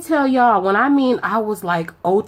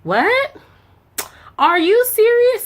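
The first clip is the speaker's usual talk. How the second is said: fake shock; 11 semitones higher, slower than usual. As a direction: overact surprise at the caller. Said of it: "I'm acting at this point".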